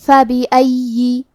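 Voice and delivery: فَبِأَيِّ with fatha, kasra and a doubled ya held two beats; careful tajweed. Shown as a demonstration. Teacher showing students the word, slow and careful. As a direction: say فَبِأَيِّ slowly, each short vowel clear. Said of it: "تو ہم زیر کو ظاہر کریں گے".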